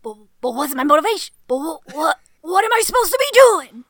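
loud, rising hammed-up Pacino rant; Al Pacino shout. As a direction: build to a loud Pacino yell. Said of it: "get real gruff".

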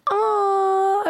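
long, high, held aww; mock-coo high, as smitten girls would; imitated cooing. Imitating girls told she's a Cancer, imitated cooing. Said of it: "girls be like".

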